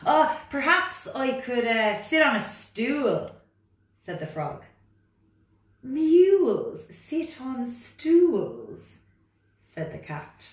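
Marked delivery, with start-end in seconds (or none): 0.0-3.3 high, loud, protesting frog voice; reluctant frog. Acted. Frog character. 5.8-9.0 loud, high, bossy cat voice. Acted. Cat character.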